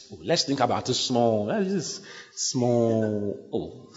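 the devil bargaining, sly and coaxing. Retold or imitated. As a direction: lure them in sweetly, stretching the words. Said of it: "Sometimes the devil try to have a deal with you".

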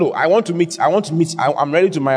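ordinary talk

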